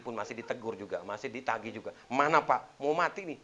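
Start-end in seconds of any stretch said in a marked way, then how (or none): 2.1-3.4 raised, high-pitched badgering; acted pestering for what was promised, much louder; loud nagging demand. Wife dunning her dying husband for his promise, loud nagging.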